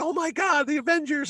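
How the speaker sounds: high-pitched, giddy squeal; starstruck fanboy. Retold or imitated. Fan trying to act cool near the Avengers, high giddy squeal.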